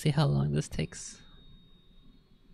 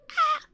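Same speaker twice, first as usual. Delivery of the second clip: startled yelp; 8 dB louder than usual. Real, not acted. Cat startles him.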